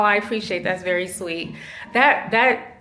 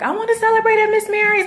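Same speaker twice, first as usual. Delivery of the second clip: eager wish; 5 dB louder, faster than usual. Retold or imitated. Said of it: "I was like".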